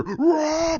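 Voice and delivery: high, throaty imitated roar; mock Hulk roar. Fan acts out Hulk charging bad guys, mock roar. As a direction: let out a playful monster roar.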